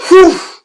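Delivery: sudden loud, high, airy whoosh. Retold or imitated. Angler imitates the mako leaping again, explosive whoosh. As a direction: burst of imitated rushing noise.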